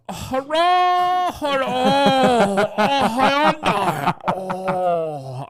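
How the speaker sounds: long, high, loud drawn-out cries; impulsive outburst. Retold or imitated. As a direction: let it all out, stretching each cry. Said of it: "Get it out before we get out of the van".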